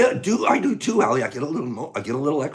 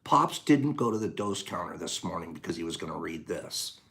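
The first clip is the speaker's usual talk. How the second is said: usual speaking voice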